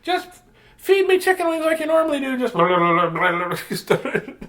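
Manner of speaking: squeaky beard demanding chicken; the beard bossing its owner about food; talking beard voice. Acted. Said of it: "bird would be like".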